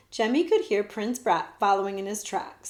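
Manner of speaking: ordinary delivery, nothing marked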